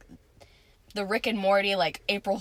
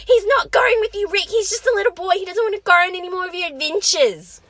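high mock yelling mom; 9 semitones higher, 6 dB louder than usual. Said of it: "Morty's like".

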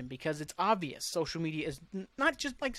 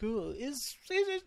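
mocking; 7 semitones higher, 5 dB quieter, slower than usual. Mocking people asking obvious question.

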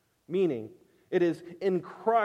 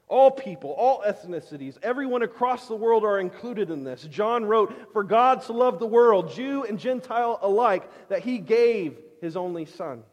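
loud, higher impassioned preaching; preaching at full force; 5 semitones higher, 7 dB louder than usual. Acted. Preacher telling the congregation God loved the world, loud and impassioned. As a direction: proclaim it to the whole room. Preacher stressing everyone is included.

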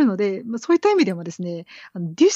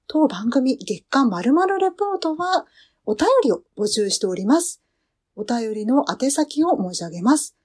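announcer read; 5 semitones higher than usual. Acted. Reads the mail address and outro announcement.

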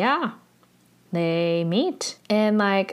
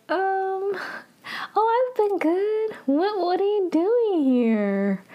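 imitating awkwardness in a much higher, stretched-out voice; awkward reply; 12 semitones higher, slower than usual. Replying to a long-avoided friend at the cafe, hammed-up awkward. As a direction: squirm through the small talk, pitched way up. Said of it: "they're very awkward".

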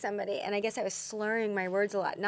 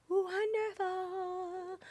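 high, long-drawn sung-out praise; swooning, stretched-out delight; gushing sing-song; 10 semitones higher, 8 dB quieter, much slower than usual. Real, not acted. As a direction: draw out WONDERFUL on a high held note.

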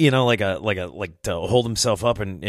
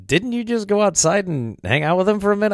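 much higher voice for her question; high-pitched mock quote; imitated woman; 11 semitones higher than usual.

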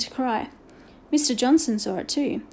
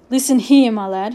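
stern and menacing, first words hit loud; fearsome tones; 6 dB louder than usual. A narrator's reading. Headmaster looms over the giggling boy, fearsome tones. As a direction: fearsome headmaster laying down the law. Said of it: "he said in fearsome tones".

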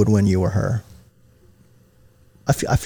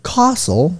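CASTLE in a higher British voice, broad first vowel; British accent; 6 semitones higher than usual. Shown as a demonstration. Host coaching friend to sound British, demonstrating British accent. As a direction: model a British "Castle" for someone to copy. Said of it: "Say castle".

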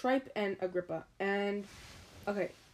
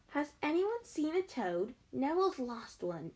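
put-on higher, bossy girl's voice; 6 semitones higher than usual. Girl asks compartment about lost toad, bossy. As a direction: demand it in a bossy lilt. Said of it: "She had a bossy sort of vo".

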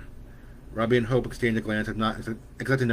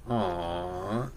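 low, soft disappointed aw; boy sulking at his mother's rule; 4 semitones lower, 6 dB quieter than usual. A narrator's reading. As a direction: let the boy sulk. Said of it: "Stephen sulked".

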